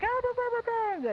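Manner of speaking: high-pitched mock babble; mocking mom imitation. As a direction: imitate mom's yammering way up high. Mimicking mom's reaction to Rick.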